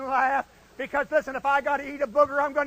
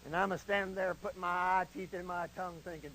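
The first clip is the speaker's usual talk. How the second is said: usual speaking voice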